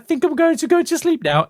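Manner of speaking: high, put-on woozy falsetto; fainting Julia Child parody. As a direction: TV cook about to pass out, played for laughs. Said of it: "And then she just passes out".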